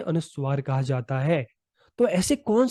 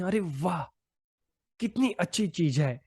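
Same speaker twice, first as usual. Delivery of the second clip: softly demonstrated wonder; understated, softer exclamation of admiring surprise; mild surprise; 3 dB quieter than usual. Shown as a demonstration. Teacher showing students how intonation expresses surprise, mildly amazed. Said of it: "कभी आश्चर्य प्रकट करते हैं".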